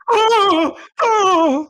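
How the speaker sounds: high frightened wail; scared wail. Retold or imitated. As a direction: wail in mock terror. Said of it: "you acted so scared the whole time".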